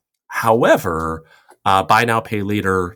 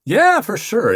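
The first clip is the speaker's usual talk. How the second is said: bright, high eager yes; excited; 6 dB louder, slower than usual. Real, not acted. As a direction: jump in with enthusiasm. Eager answer to question.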